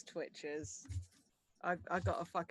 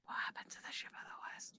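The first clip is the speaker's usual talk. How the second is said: whispered; 7 dB quieter, faster than usual. Real, not acted. Player asks eagerly, hushed.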